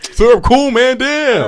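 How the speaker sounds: loud, high mock protest; mock Jacoby. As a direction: squeal it out like a kid caught out. Friend mocks Jacoby loudly.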